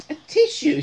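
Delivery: loud, high comic plea; sneezy character's high call; high sneezy squeak. Acted. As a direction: squeal for a tissue.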